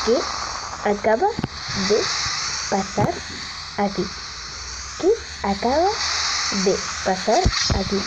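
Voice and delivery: "¿Qué acaba de pasar aquí?" broken by long pauses after each word; halting, isolated words with "pasar" lifting at the end; baffled, word by word. Real, not acted. Baffled by game event.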